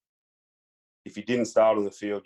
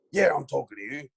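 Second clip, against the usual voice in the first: higher-pitched imitated challenge; Martin Pike; 5 semitones higher than usual. Opponent insisting the ignored rookie answer him, mock challenge. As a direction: retell a pointed confrontation. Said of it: "He goes".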